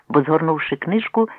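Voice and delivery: ordinary delivery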